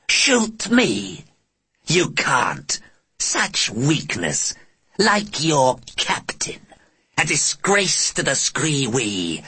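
slow, high, scornful alien voice; taunting alien. Acted. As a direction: taunt him slowly like a sneering alien.

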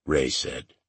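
ordinary delivery

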